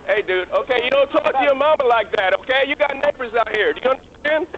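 loud, stern dressing-down; officer yelling at a man for shouting at his mother; angry scolding. Real, not acted. Officer scolds a man in front of neighbors, loud and stern.

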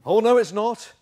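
loud panto denial, pitched high; hammed-up projected contradiction; pantomime retort. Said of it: "it's almost pantomime".

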